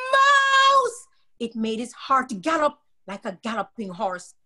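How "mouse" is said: very high, loud shriek; scream. A narrator's reading. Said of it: "every time Ronald screamed".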